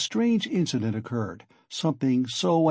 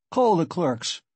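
higher-pitched, defiant challenge; heated, emphatic command; angry defiance; 5 semitones higher, 3 dB louder, slower than usual. Acted. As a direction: dare him to do it. Accused man defies the warning.

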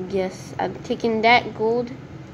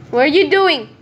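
louder and higher, falling at the end; rising alarm at a stranger; alarmed repeat; 6 semitones higher, 7 dB louder than usual. Real, not acted. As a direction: push the question at the intruder. Player keeps approaching.

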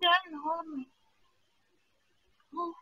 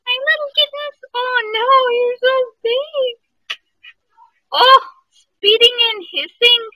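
squealing at tiny kittens; excited cooing; 5 semitones higher, 15 dB louder than usual. Real, not acted. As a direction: coo over the kittens excitedly. Cooing at kittens, loud high.

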